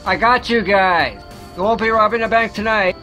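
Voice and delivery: loud, triumphant superhero call; heroic shout. Acted. Hero catching the robbers, loud triumphant shout. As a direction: boom it out like a caped hero.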